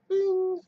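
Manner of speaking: loud high sung bing like a warning chime; mock chime. Agent mocking the insurer's alarm, loud high bing. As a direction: ring it out like an alarm bell.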